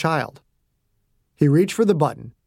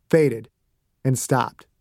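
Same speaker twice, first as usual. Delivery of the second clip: plain reading voice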